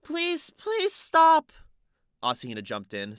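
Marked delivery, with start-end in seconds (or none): 0.0-1.5 put-on high, very slow drunken slur; slurred voice. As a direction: slur it like a tipsy girl. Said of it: "speaking like a slurred voice".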